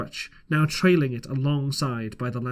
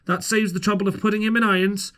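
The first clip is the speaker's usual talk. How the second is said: dry captain's remark; 6 semitones higher than usual. A narrator's reading. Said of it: "said the captain".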